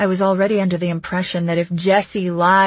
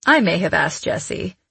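usual speaking voice

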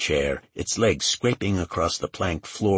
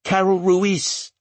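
high-pitched, anxious character voice; pitched-up, defensive witness; nervous young assistant; 10 semitones higher, 4 dB louder than usual. A narrator's reading. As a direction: answer questioning in a high, hesitant voice.

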